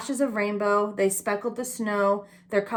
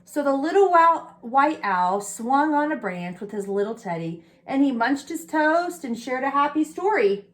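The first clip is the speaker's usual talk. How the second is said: plain reading voice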